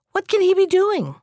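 high-pitched puzzled exclamation; exclaiming. A narrator's reading. Youth to the hermit about the man in fog, exclaiming. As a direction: ask in wonder, pitch jumping up. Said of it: "exclaimed the youth".

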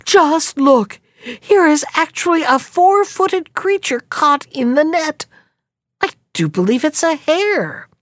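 mild mock amazed call. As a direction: call to the wife from the net. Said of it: "he called to his wife".

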